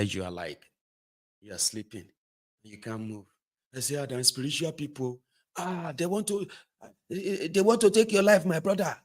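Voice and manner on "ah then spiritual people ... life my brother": high, rising spooked voice; mock-frightened believer. To his brother, warning of spirits: high hammed-up fear. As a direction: ham up a superstitious friend.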